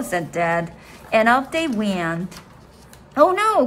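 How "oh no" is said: a high, loud little "oh no"; dismayed cry. Acted. Froggy realizing he forgot something, crying out in dismay. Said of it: "cried Froggy".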